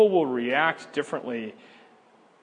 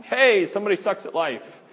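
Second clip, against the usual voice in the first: higher, louder mock announcement to the whole church; preacher mocking a public call-out of a sinner; 6 semitones higher, 6 dB louder than usual. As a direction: ham up a blunt call-out from the pulpit. Mock Sunday-morning call-out.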